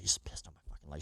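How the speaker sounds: hushed, barely audible aside; stunned whisper. Retold or imitated. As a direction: whisper it as a horrified private realization. Retold stunned realization about the leg.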